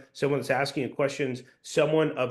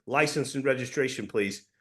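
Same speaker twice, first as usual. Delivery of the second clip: cop tone. Shown as a demonstration. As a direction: say it as an order, dropping on please. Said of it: "the way a cop would talk to you".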